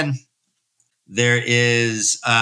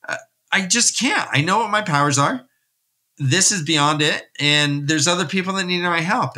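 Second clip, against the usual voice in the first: higher, mildly exasperated snap; snapping; 6 semitones higher than usual. Retold or imitated. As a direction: snap back at him, pitch raised. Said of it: "she kinda snaps and says".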